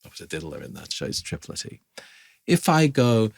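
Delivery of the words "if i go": usual speaking voice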